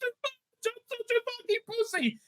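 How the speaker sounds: very high squeaky mocking whine; mock whimper. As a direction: mimic them as pathetic crybabies. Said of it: "they're like".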